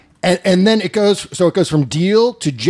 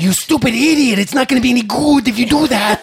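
higher, nasal-free cartoonish mock voice; Peter Lorre impression; 8 semitones higher than usual. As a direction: ham up a Ren from Ren and Stimpy rant. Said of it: "He's doing Peter Lorre or something".

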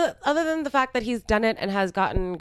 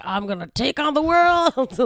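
high, drawn-out hammed-up rallying cry; mock triumphant yell, stretching the words; pumped-up self-help bravado; 8 semitones higher, 3 dB louder, slower than usual. Hyping oneself up with a self-help tape, mock-triumphant. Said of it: "being like".

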